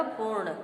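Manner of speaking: पूर्ण with a tight rn cluster, poorn; correct pronunciation. Shown as a demonstration. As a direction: close पूर्ण on the joined rn. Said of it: "इसका सही उच्चारण होगा".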